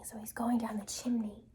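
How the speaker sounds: hushed sneaky whisper; very soft conspiratorial whisper. A narrator's reading. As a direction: whisper it like a secret plan. Wolf sneaking down chimney.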